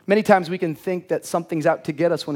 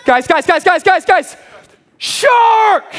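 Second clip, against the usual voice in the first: much higher, louder, rising into a screamed shark; panicked shark alarm; 14 semitones higher, 9 dB louder, much slower than usual. Retold or imitated. Brother-in-law warning friends of a shark, panicked high scream. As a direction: sound the alarm in sheer panic.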